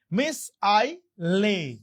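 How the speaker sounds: মিস আইলে very slow, in separate chunks; syllable by syllable. Shown as a demonstration. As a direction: pause between each part of মিস আইলে. Teacher splits missile for spelling.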